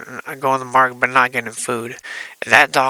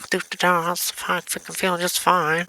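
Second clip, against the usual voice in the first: higher, singsong bragging; imitating a jaunty uncle; carefree dancing uncle; 6 semitones higher than usual. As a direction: lilt it as if dancing along. Uncle dances around with cane.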